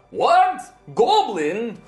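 startled big warrior; surprised barbarian. Acted. Minsk surprised at goblin.

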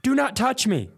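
high, pleading don't-touch-me; high desperate retold plea. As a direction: beg her not to touch, high and strained. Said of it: "I go".

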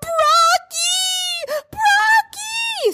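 high, loud crying wail for broccoli; imitating a sobbing toddler. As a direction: wail it like a little kid in a tantrum. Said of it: "Screaming, crying".

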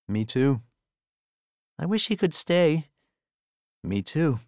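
ordinary delivery

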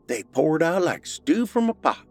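Missus Warren voice. A narrator's reading. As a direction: play the weary rural mother. Missus Warren tells chicken story.

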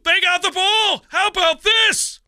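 loud, high-pitched shouted announcement; excited mock call. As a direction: shout it like a thrilled commentator. Acting out an excited broadcast call.

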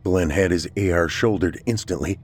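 ordinary delivery, nothing marked

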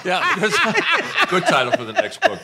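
amused echo of a good quip; laughing. Real, not acted. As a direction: chuckle out the repeat of her joke. Laughing repeat of a joke.

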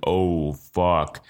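low, drawn-out mock realization; slow-motion dread. Passenger realizing he is drunk on the plane, slow-motion dread.

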